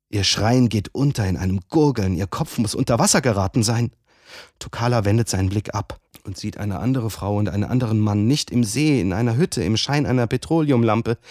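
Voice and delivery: usual speaking voice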